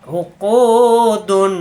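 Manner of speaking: উকুদুন with কু held long, slow; stretched vowel. Shown as a demonstration. Teacher stretching উকুদুন slowly for the class. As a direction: draw out the কু in উকুদুন. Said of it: "এই কু এক আলিফ টান হবে".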